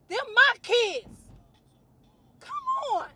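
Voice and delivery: very loud, high cry of protest; indignant, high-pitched yell; exasperated outburst. Real, not acted. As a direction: wail it loudly, stretching the words out. Upset outburst over an imagined lost life.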